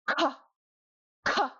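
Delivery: "ka" high, with a strong puff of air; hard aspirated K. Shown as a demonstration. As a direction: blast breath through the K of "ka". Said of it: "It's really hard".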